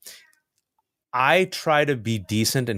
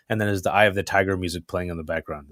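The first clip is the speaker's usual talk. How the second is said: ordinary talk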